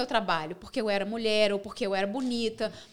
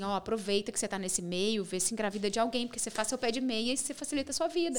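softer, lower aside, as if advising a friend; retold confidential advice; 3 semitones lower, 6 dB quieter than usual. Friends advising her about her life, retold in a low aside. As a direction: quietly pass on dubious advice.

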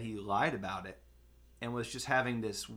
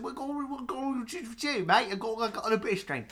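much higher, garbled fake British accent; mock accent; 10 semitones higher than usual. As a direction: babble a silly foreign accent, fast. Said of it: "I said".

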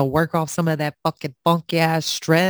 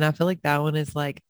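usual speaking voice

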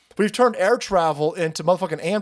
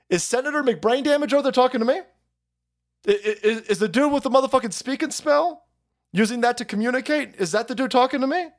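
higher, quick, incredulous mock voice; mock House member; 7 semitones higher than usual. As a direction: play a baffled congressman, fast and up high. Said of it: "I want one of these jagoffs from the House to say".

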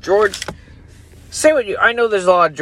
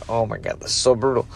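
normal talking voice

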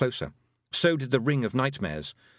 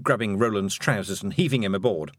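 usual speaking voice

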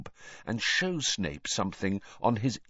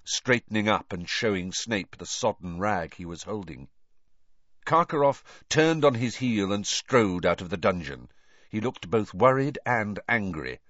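ordinary delivery

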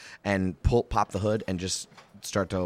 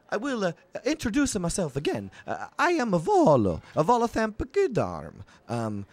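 much higher, eager stammering writer; Volo; 8 semitones higher, 4 dB louder than usual. Acted. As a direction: fuss and fluster like a pompous little chronicler. Volo again.

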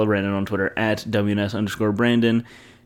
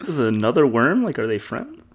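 ordinary talk

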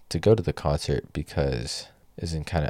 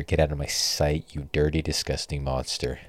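usual speaking voice

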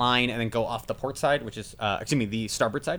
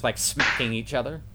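ordinary delivery

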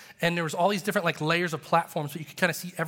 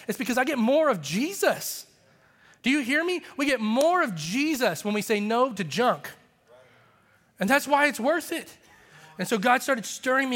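much higher, driving preacher's cry; full-force preaching; 6 semitones higher than usual. Acted. Pastor calling his church to holiness, preaching at full force. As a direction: lift the congregation with rising fervor.

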